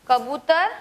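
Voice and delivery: ordinary talk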